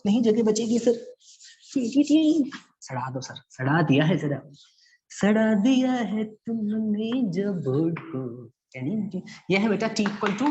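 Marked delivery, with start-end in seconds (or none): 1.7-2.5 sound effect. Acted. Teacher imitates sound effect. 5.1-8.5 drawn out. Acted. Slow drawn-out reply.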